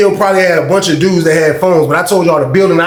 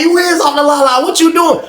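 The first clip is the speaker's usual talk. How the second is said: high-pitched mock accusation; accusing wife; 10 semitones higher than usual. Wife confronting her giggling husband, high and accusing. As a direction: imitate a wife catching him out.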